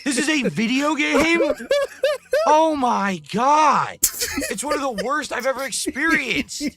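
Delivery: high, loud exasperated ranting; angry rant. Real, not acted. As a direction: vent in disbelief at the screen. Ranting about the game.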